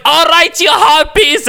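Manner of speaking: high mock Irish accent, quoting Banshee; a hammy stage-Irish jab; bad Irish accent. Quoting Banshee's line to the girls, high mock Irish accent. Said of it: "[name]'s Irish accent is amazingly bad".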